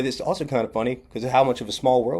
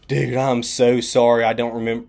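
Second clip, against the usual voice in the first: emphatic apology; 2 semitones higher, 7 dB louder than usual. Retold or imitated. Stranger's big apology retold.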